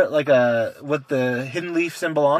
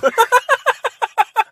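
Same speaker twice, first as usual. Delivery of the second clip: high laugh; 5 dB louder than usual. Real, not acted. Laugh after mother quote.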